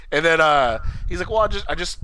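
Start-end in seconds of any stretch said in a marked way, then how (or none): 1.3-2.0 stuttering. Retold or imitated. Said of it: "he started stuttering too".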